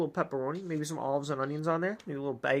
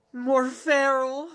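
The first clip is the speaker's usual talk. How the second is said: slow ominous emphasis; 8 semitones higher, 4 dB louder, much slower than usual. Acted. Drawn out ominous narration.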